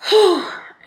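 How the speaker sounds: loud, high exasperated sigh; heavy sigh. Real, not acted. Overwhelmed by her exam trouble, a loud exasperated sigh. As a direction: let out a big overwhelmed sigh.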